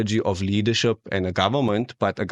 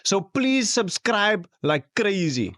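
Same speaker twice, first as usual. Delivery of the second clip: slow, higher drawn-out plea; hammed-up plea; 8 semitones higher, much slower than usual. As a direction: stretch it out mockingly.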